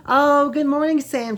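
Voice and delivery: a mother greeting sweetly through terror; creepy over-bright morning greeting; grotesque friendly mother. A narrator's reading. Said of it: "she said in a grotesque, friendly tone".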